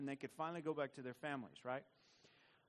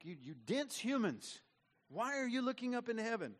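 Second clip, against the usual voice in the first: rebuke; 7 semitones higher than usual. Retold or imitated. Angels rebuking the disciples, mock scolding. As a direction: scold the dense humans. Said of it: "it's kind of a rebuke".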